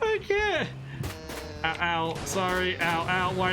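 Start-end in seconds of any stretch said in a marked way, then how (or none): 0.0-0.7 high squealing laugh, then breathless words; laughing scream. Real, not acted. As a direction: lose it completely laughing. Laughing at repeated falls.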